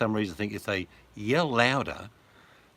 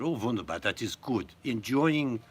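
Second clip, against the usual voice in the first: mock German accent; 6 semitones higher, 5 dB louder than usual. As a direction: fake German accent, slipping into Russian. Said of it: "your accent started to turn a bit Russian".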